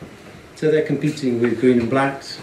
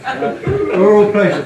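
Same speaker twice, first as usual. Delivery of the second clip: laughing; 7 semitones higher, 6 dB louder, slower than usual. Real, not acted. Reading pun name laughing.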